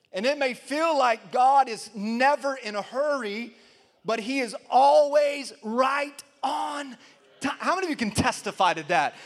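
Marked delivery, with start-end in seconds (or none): 0.1-7.6 slow, stretched pulpit proclamation; emphatic preaching. Real, not acted. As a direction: build to a raised, ringing finish. Preacher proclaims at full force.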